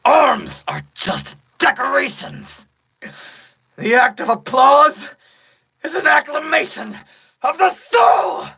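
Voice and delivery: screaming villain climax; villain's shouted proclamation. Acted. Villain's speech building to a shout.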